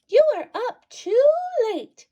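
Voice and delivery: high, loud squeaky mouse voice; a little mouse scolding his friend, high and squeaky. Acted. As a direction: squeak it out like a tiny fussing mouse. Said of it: "Mouse squeaks".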